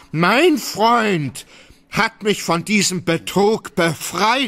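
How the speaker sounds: high, triumphant answer with a laugh; proud retort. Acted. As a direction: crow over the rival's defeat. (stolzer, lachender Triumph)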